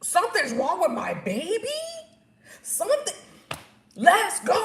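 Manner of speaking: high, loud re-enacted panic; alarmed outcry. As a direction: cry out in alarm, rallying for action. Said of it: "Something in my mind was like".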